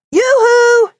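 high, loud call to the crowd. A narrator's reading. Penguin calling to unseen visitors, loud high call. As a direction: shout it up to the camera. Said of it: "he called".

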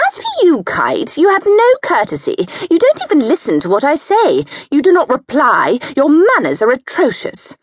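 high, loud, fast berating; bullying scold. A narrator's reading. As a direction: tear into her sister-in-law. Satipi berating Kite.

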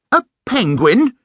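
high, affronted question; upset exclamation. A narrator's reading. Bear stung by Missus Brown's words, upset exclamation. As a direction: echo it in offended disbelief, pitched right up. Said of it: "exclaimed Paddington, looking most upset".

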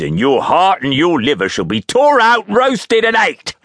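building to a high, loud climax; fearful threat. A narrator's reading. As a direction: escalate the threat into a yell. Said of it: "went on in these fearful terms".

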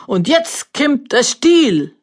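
high, slow, loud announcer. A narrator's reading. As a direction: call it out like a fairground announcer. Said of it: "Der Ansager blätterte".

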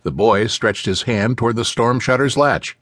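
usual speaking voice

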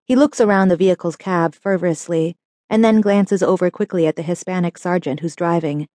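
ordinary delivery, nothing marked

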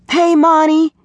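put-on high-pitched boy's call. A narrator's reading. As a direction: hail a friend through the window. Boy calls through window screen.

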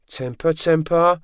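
high, slow sing-song teasing; mocking, amused chiding; grinning taunt. A narrator's reading. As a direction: tease it slowly, high and drawn out. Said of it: "George grinned".

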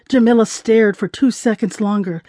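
ordinary delivery, nothing marked

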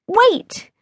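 high, cross protest. A narrator's reading. Sophia resists being dragged from the library, cross. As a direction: stop him, crossly. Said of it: "I said crossly".

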